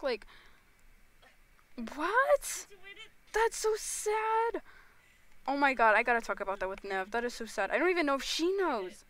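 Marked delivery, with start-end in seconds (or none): 2.0-4.6 high, shocked exclamation imitating a text. Streamer showing how she texts people, in shock. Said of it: "like, in shock".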